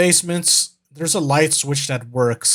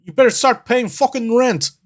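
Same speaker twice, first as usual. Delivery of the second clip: raised, hammed-up British challenge; bad British accent; 5 semitones higher than usual. British guy demanding rent from whatever lurks, in a bad British accent. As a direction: call out in a badly faked British accent. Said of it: "bad British".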